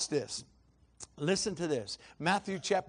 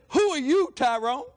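booming high, loud challenge; God calling out in full voice; thundering voice of God; 10 semitones higher, 11 dB louder than usual. Retold or imitated. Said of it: "God thundered in my".

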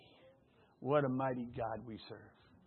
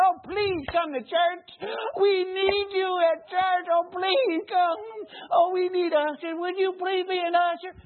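much higher, louder, slow pleading with a mock sob; mock begging; 10 dB louder, slower than usual. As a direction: ham up a desperate, tearful plea to come to church. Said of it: "He don't say".

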